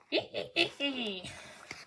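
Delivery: breathy whispered villain snicker; a sly hissing laugh, unvoiced; Doflamingo laugh. Imitating Doflamingo before the quote, breathy snicker. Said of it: "I have an amazing Doflamingo impression".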